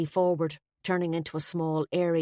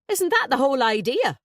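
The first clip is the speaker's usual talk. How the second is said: teasing; 8 semitones higher, 5 dB louder than usual. A narrator's reading. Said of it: "still grinning".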